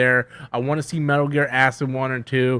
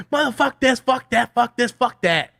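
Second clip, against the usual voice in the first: high-pitched mock rant, cursing fast; 9 semitones higher than usual. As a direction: spit out the curses in a hammed-up tantrum. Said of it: "just rant about everything".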